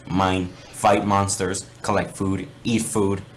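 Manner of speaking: ordinary talk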